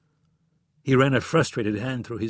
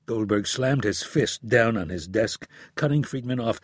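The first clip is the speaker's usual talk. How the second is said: plain reading voice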